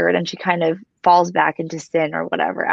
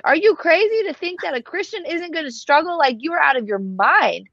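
much higher, exasperated disbelief; incredulous retort; 8 semitones higher than usual. Retold or imitated. To critics of the show, a high incredulous retort. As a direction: scoff at how crazy the idea is. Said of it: "people like me are like".

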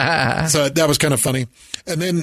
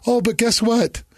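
higher-pitched mimicked announcement; imitating crying girl; 9 semitones higher than usual. Ex springs news on old boyfriend, high mimicry. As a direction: lightly play the ex with news, voice up. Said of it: "And then she goes".

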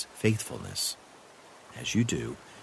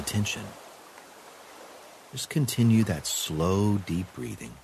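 calm meditative tone. Acted. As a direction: guide the breath calmly. Guided breathing exercise.

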